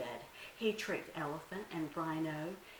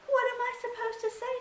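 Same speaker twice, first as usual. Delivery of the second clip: much higher, louder innocent little deer; Little Bush Deer; 6 dB louder, faster than usual. Acted. Little deer plays innocent to trick Anansi, high and sweet. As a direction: act innocent, a small high voice.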